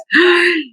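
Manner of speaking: sharp high gasp; playful shocked gasp; mock gasp. Shocked at the word okis.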